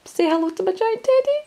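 high, sing-song hello; playful high voice. Real, not acted. Introducing teddy to viewers.

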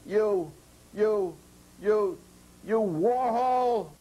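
furious stammered curse at the window; sputtering fury. Acted. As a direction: choke on rage until the name bursts out. Red furious, shaking his fist.

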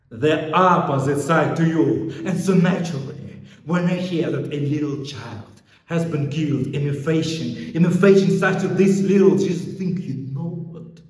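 loud, high, mounting outrage; angry accusation. Acted. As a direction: raise the voice and accuse. Policeman turns on the suspect.

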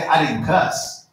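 normal talking voice

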